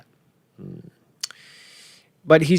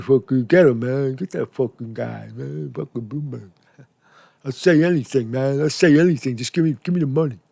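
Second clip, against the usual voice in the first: a put-on voice, mostly softer, mocking the guy in the clip; mock charlatan voice; 4 dB quieter than usual. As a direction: play him as a sleazy hustler who'll say anything, in a quieter put-on voice.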